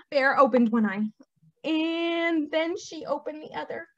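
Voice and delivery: lively, sing-song storybook delivery; animated picture-book narration; story reading to children. A narrator's reading. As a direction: read it to little ones with big pitch swings.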